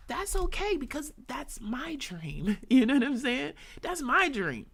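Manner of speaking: high, loud laughing boast; giddy laugh over own dream. Real, not acted. Laughing about own big dream.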